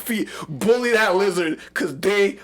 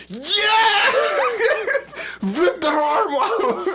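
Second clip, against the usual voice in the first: high, shouted whoop breaking into laughter; gleeful cheering; much slower than usual. Retold or imitated. Fan re-enacting watching a bar fight, gleeful laughing cheer.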